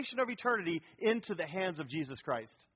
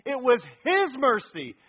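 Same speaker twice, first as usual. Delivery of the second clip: His stretched, higher and louder, slow; slow, raised "His", weight on God's mercy; stress on His; 5 semitones higher, 7 dB louder than usual. Shown as a demonstration. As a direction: lean hard on "His" in "It was His mercy". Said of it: "His is also emphatic".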